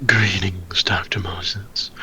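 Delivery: slow, whispered smug greeting; a sly figure whispering his welcome. Acted. Character greets Moses softly.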